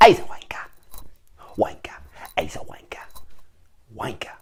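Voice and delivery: breathy sly taunt; sneaky whispered heckle. Acted. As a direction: whisper the insult aside.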